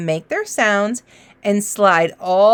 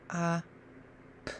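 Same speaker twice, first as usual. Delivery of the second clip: ordinary talk, nothing marked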